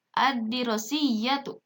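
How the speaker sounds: slow "Ad-dirosiyatu", lam merged into doubled D; silent lam. Shown as a demonstration. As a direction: read "Ad-dirosiyatu" deliberately with no L. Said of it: "lamnya jangan sampai terdengar jelas".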